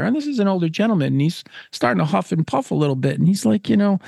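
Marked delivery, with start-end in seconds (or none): none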